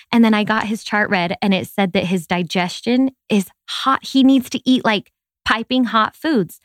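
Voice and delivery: ordinary talk, nothing marked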